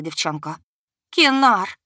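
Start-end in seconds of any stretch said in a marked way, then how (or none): 1.1-1.8 loud, high plea; yearning cry. A narrator's reading. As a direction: reach out to him. Goddess reaches for her dragon. (отчаянный зов)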